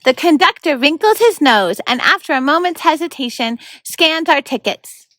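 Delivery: lively read-aloud narration; story narration. A narrator's reading. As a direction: read it like a bedtime chapter. Narrator reads chapter aloud.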